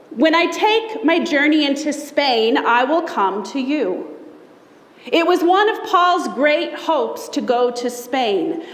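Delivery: normal talking voice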